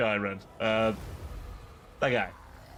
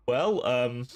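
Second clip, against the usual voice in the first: ordinary talk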